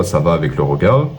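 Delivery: ordinary talk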